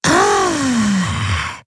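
very high, drawn-out awed ah; a wide-eyed, high-pitched ah; childlike fascination. A narrator's reading. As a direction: marvel at it like a child. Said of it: "in fast kindischer Faszination".

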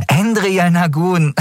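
Endriel Nagun drawn out, high and mocking. A narrator's reading. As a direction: sneer at Endriel Nagun, slowly. Girl mocks the captain's name.

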